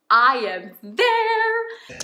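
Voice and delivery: high, loud, drawn-out exclamation; hyped up. Retold or imitated. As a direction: stretch it out, hyped for the finish. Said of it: "you really start getting hyped up".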